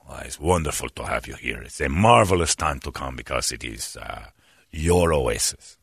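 low, soft, smooth attendant; beautiful speaking voice. Retold or imitated. Attendant welcoming a guy to the oasis, beautifully spoken. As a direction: imitate a suave, deep-voiced host. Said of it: "He's got a beautiful speaking voice".

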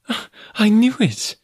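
high-pitched woman's exclamation; exclaiming. A narrator's reading. Girl hears why he did not return, exclaiming. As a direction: burst out with it, high. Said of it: "she exclaimed".

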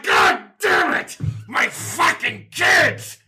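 slow roaring curse; slow furious monster roar; enraged monster. Acted. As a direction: bellow it like a furious beast.

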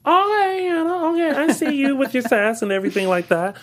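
put-on high-pitched, impressed "okay, girl" reaction; playful hyping. Fan reacting to a sassy dancer, playful hyping.

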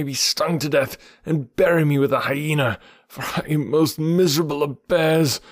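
put-on high, lamenting old bear; a bear wailing in self-pity; Baloo the bear. A narrator's reading. Baloo grieving to Bagheera over lost Mowgli.

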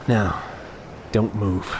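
low, soft threatening voice; menacing quiet. Acted. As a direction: lean close and threaten softly. Menacing the helpless victim.

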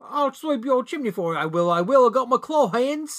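high, hammed-up British chimney-sweep accent; fake British accent. Clawed creature offering to sweep chimneys, fake British accent. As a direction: do a bad British accent, nearly Australian. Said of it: "Can't do that accent?".

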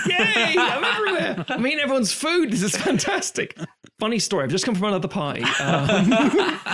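high, loud, giddy arrival with laughs; gleeful party crasher. Pretend. Guest who crashed the dinner party, excited high voice. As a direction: burst in delighted to be everywhere.

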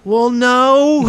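high, drawn-out reluctant admission; hammed-up sheepish confession; sheepish husband. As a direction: admit it in a high, guilty voice. Husband admits it to wife.

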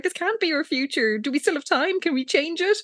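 high-pitched mock panic; mock freaked out. Podcaster acting out Lila and Jessica facing their future, freaked out. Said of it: "getting really freaked out".

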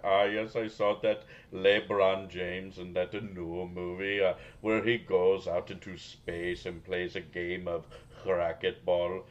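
put-on a lightly done Indian accent, eager pop-culture fan; excited Indian guy voice. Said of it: "it features Indian voice".

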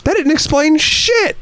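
very high, slow disbelieving whine; incredulous. Retold or imitated. Answering Brendan after the movie, a high slow disbelieving whine. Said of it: "I looked at him and I said".

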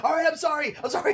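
high-pitched frantic apologizing; mock panicked apology. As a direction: act out begging off from a threat. Acted apology at gunpoint.

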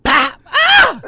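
loud slap sound then a high yelp; pop and yelp. Retold or imitated. Acting out the wife's hit with a slap and a yelp. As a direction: smack noise and a sharp cry of pain.

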